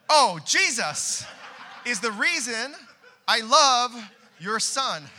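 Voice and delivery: loud, slowed, laughing declaration; laughing exclamation. Retold or imitated. Pastor answering the father on the steps, loud laughing reply. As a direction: blurt it out through laughter, caught off guard. Quick recovery after wife's cue.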